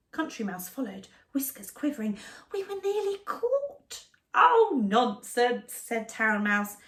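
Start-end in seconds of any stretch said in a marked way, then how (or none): 2.5-4.1 high, slow trembling mouse; frightened mouse. Acted. Frightened mouse after escape. 4.3-5.7 slow, drawn-out scoff; dismissive. Acted. Town Mouse dismisses fear.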